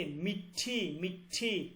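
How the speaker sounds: "মিঠি" with a held, aspirated ঠ; teacher's careful "মিঠি", stressing the ঠ sound; aspirated retroflex ঠ. Shown as a demonstration. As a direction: say "মিঠি" with a clear puff after the retroflex t. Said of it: "তখন উচ্চারণ হবে ঠ".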